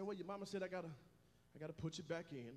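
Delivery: put-on a gentle old man talking to a baby; grandfather voiced. Grandfather voiced, low and soft.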